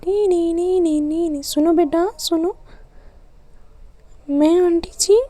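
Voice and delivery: put-on high, childish squeaky voice; little child. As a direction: pipe up like a small kid. Child voice calling aunt.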